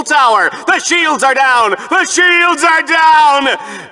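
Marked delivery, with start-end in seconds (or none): 1.7-3.9 slow, hammed-up dramatic shout, much higher and stretched; milk the doom for all it's worth; overly dramatic. Shown as a demonstration. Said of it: "Was that too dramatic?".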